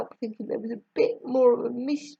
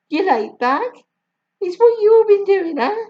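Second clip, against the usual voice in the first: much higher, louder Jamaican mother teasing; Jamaican accent; 11 semitones higher, 7 dB louder than usual. A narrator's reading. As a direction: Jamaican mum teasing the kids. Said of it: "I will try my best".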